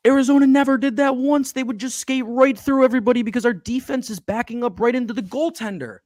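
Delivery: high, loud, angry outburst; letting it all out at the team; furious fan venting at the defense; heated rant. Real, not acted. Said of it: "Sorry, that was a lot".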